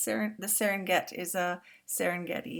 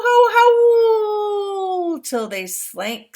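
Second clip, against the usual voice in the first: much higher, louder, very slow howling words; long howl for the hyenas; drawn-out howl; 19 dB louder, much slower than usual. Acted. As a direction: stretch it out like a howling animal. Story father describes howling.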